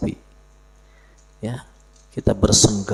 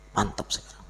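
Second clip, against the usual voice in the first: whisper; 9 dB quieter, faster than usual. Real, not acted. Whispered aside.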